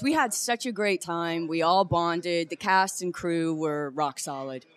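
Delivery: ordinary talk, nothing marked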